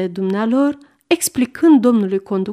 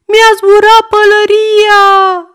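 put-on a boy screaming, much higher and louder, slow; bawling child; 12 semitones higher, 9 dB louder, slower than usual. A narrator's reading. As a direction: stamp his feet and yell for the train to stop. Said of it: "zbiară și mai tare Goe". (Goe zbiară și bate din picioare, mult mai sus și mai tare)

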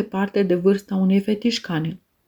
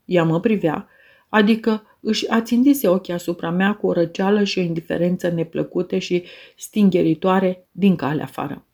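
ordinary delivery

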